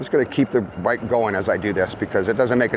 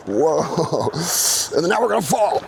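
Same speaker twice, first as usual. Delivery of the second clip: alarmed; 7 semitones higher than usual. Real, not acted. Bike crash as it happens.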